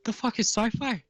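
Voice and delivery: high, baffled gnome. Acted. Gnome shopkeeper baffled by the customer's question, high voice. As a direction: squeak out the confusion.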